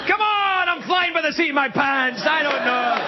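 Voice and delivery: excited self-defence while laughing; flustered outburst. Real, not acted. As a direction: throw up your hands, half laughing. Flustered after losing his train of thought.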